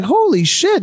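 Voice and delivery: sudden high, loud "holy shit"; a startled gasp of disbelief; shocked exclamation. Retold or imitated. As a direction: blurt it out in shock. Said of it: "And I'm like".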